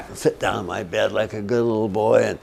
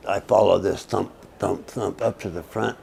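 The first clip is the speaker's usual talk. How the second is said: normal talking voice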